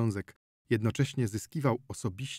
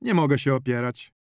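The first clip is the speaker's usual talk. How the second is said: louder, formal, by-the-book; official tone; 4 semitones higher, 6 dB louder than usual. Acted. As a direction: speak like a clerk on duty. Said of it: "urzędowym tonem powiedział ziomek". (urzędowym tonem)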